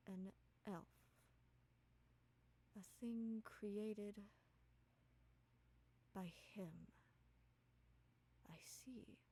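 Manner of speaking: slow, hushed authority, broken by long pauses; soft, authoritative. Acted. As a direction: let each phrase fall softly and deliberately. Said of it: "in a very authoritative voice, but also soft".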